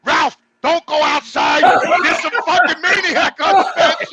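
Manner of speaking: loud, high mock-panicked warning, laughing at the end; panicked old neighbor. Imagined old neighbor warning Ralph about the maniac, hammed-up panic. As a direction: play the scared neighbor hammed up. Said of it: "old people saying".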